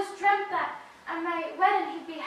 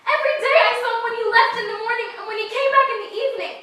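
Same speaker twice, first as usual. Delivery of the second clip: much louder, higher, near tears; hurt and cornered, lashing out fast; upset outburst; 4 semitones higher, 9 dB louder than usual. Acted. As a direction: she cries out, louder and higher. Upset girl pleading, voice raised.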